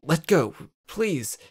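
ordinary delivery, nothing marked

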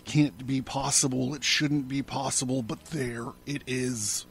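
usual speaking voice

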